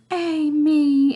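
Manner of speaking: lightly voiced lovesick Juliet; sighing Juliet. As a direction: sigh it out like a dreamy girl. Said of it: "and she goes".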